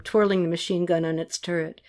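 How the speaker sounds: ordinary delivery, nothing marked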